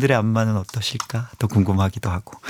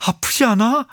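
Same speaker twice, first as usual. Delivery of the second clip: much higher, acted-out question; mock worried question; 13 semitones higher than usual. Husband asking wife while scratching her back, high worried voice. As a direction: ask high, as if checking for pain.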